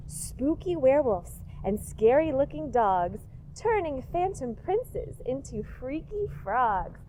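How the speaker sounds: ordinary delivery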